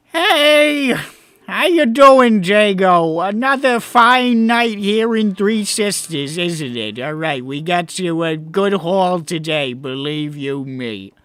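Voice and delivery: loud, high, chummy goblin greeting; Gugglemuck voice. Acted. Gugglemuck hails Jago, a loud high cheerful greeting. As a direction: boom out a cheery hello like a pushy small-time crook.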